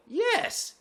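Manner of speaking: high, drawn-out delighted yes; elated confirmation. Retold or imitated. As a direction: re-enact triumphant relief at finally being understood. Relieved answer to cashier's right order.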